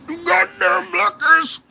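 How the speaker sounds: high, slow, strained mock fury. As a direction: squeal the insult like a powerless bigot. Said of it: "he's like".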